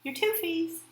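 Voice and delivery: put-on high, cooing baby talk on toofies; cutesy baby talk. Mom repeating the silly word, cutesy and high. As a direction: coo it like a mom to a toddler. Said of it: "My what?".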